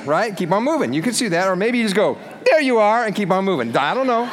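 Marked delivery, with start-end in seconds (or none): 2.4-3.0 higher and louder quick greeting; cheery passing hello. Retold or imitated. Greeting a friend while walking past, cheery.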